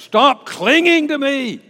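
high, loud, slow insistence; urgent plea. Acted. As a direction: press the words out firmly. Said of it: "This word means to cling".

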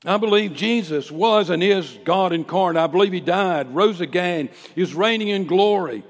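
loud, high, ringing creed; bold public declaration. Acted. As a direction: proclaim each belief at full preaching force. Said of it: "say it just like that".

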